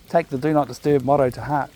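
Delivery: usual speaking voice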